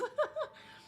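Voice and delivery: very high squealing giggle; high squeal laugh. Real, not acted. Streamer cracking up at herself, squealing laugh. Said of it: "laughing at her accent".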